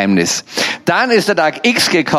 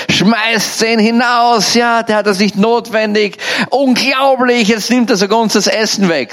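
imitated ranting shout; 6 semitones higher than usual. Man ranting at the disguised guest in the soup kitchen, imitated shouting. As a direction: act out an outraged rant without full volume. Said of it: "er schreit irgend sowas".

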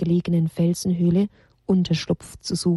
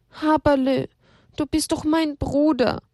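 higher, tearful little voice; tearful plea; 7 semitones higher than usual. Acted. Elia crying to grieving brother Haberle, high and tearful. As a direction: plead with your brother through tears. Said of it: "weinte Elia".

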